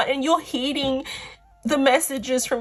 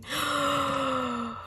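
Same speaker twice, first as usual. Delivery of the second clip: soft gasp; 3 semitones higher, 7 dB quieter than usual. Real, not acted. Gasp at revealed card.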